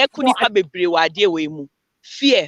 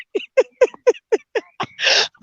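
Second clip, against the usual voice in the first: a burst of high-pitched laughter; real amused laugh at her own story. Real, not acted. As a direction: laugh it off at herself. Laughs after the scolding.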